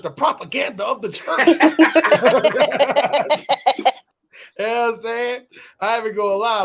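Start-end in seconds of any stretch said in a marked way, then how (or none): 1.5-3.9 loud high laughter at himself; cackling at his own preacher impression; real laugh. Real, not acted. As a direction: burst out laughing at your own joke.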